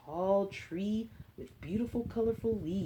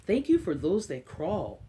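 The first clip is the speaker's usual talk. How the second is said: put-on reading to children. Librarian reading an Earth book to kids, storybook voice. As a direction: read it gently to a class of little ones.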